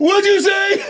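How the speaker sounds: put-on high, slow shocked yell of a girl; shocked shout. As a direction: shriek it out in disbelief. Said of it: "She goes".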